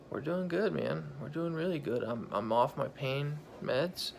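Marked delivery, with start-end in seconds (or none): none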